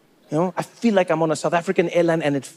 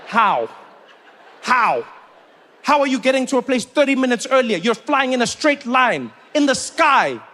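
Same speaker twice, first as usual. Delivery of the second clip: incredulous shouting; 5 semitones higher than usual. Real, not acted. Comedian baffled at the crowd, rising to a shout. Incredulous rant about short flight.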